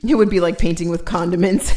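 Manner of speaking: normal talking voice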